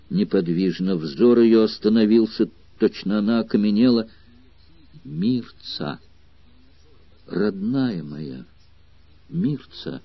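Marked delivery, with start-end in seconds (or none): 5.0-10.0 soft, slow, breath-thin voice; barely voiced, fading call of a dying man; dying man's faint call. A narrator's reading. Dying man calls his beloved by name, faint and slow. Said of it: "отчётливо произнёс ... умирающий".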